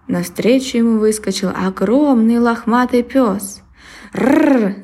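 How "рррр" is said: dog growl rolled rrr. Acted. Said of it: "зарычал пёс".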